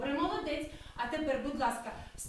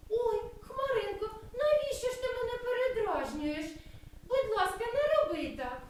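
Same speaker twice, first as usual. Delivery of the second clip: frightened mouse; 7 semitones higher than usual. Acted. Frightened mouse pleads with cloud.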